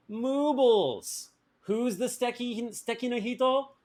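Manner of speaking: Moobles called out high and loud, question stays high; stress on Moobles. Shown as a demonstration. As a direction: announce Moobles, then ask high who it is. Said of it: "I would be saying".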